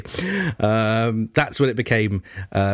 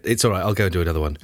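low, fast, dismissive Marx voice; offhand Marx voice; 7 semitones lower, much faster than usual. Retold or imitated. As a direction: toss it off like a bored Marx. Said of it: "Karl Marx said".

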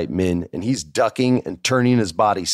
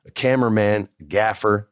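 ordinary delivery

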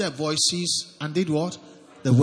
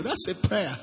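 ordinary talk

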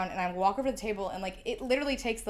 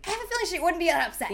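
high, loud, hurried mock doubt; exaggerated sing-song scepticism; sarcastic comeback; 9 semitones higher, 5 dB louder, faster than usual. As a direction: toss it back quickly in a raised pitch. Retells her sarcastic comeback to him.

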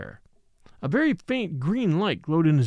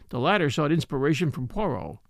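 ordinary delivery, nothing marked

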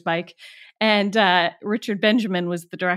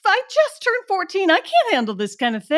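pitched way up in playful indignation; hammed-up teenage outrage at the thief; high mock outrage; 11 semitones higher than usual. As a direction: whine it like a wronged fourteen-year-old. Mock outrage over stolen bike.

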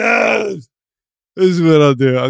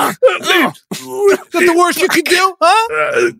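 high pained shout; taunting yell; 13 semitones higher, much slower than usual. Acted. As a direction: yell a taunt through the pain. Taunting while being beaten.

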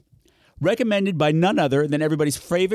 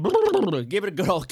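laughing; 3 semitones higher than usual. Real, not acted. Laughing through a joke.

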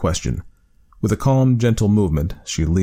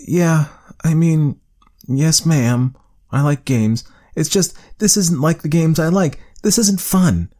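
put-on higher child voice, slow start, mildly sulky; reluctant young boy; 10 semitones higher, 3 dB louder, slower than usual. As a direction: play a boy who finds the test boring. Child reluctantly answering the doctor.

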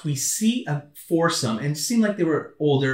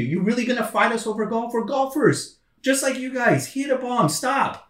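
higher-pitched protest, not shouted; mildly worked-up retold confrontation; exasperated retold challenge; 6 semitones higher than usual. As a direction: act out fed-up arguing with opponents. Retold confrontation with angry golfers.

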